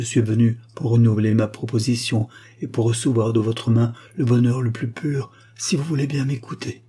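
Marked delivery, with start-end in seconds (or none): none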